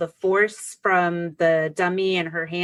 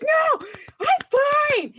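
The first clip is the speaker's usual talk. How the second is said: high, slow, laughing "I'm fine"; laughing protest; much slower than usual. Retold or imitated. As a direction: brush it off through laughter. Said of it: "I was like".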